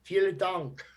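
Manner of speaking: hammed-up German accent thanks; bad German accent, jokingly; mock German accent. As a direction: put on a clumsy German accent. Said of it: "I don't know what that accent was".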